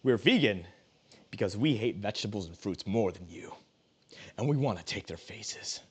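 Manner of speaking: low, quiet sinister villain; evil villain voice. Acted. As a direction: menace them in a hushed low voice. Evil vegan bit, dropping low.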